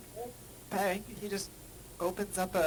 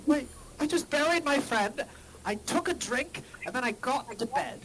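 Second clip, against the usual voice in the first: nervous pleading; 2 semitones higher, 7 dB louder than usual. Acted. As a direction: plead your innocence fast. NPC pleading innocence.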